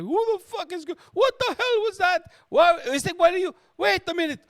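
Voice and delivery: very high, loud frantic voice; cabbie going bonkers. Acted. Cabbie shocked at his bloodied passenger, going bonkers. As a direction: shriek it like a hammed-up panicked cabbie. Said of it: "going bonkers about his cab".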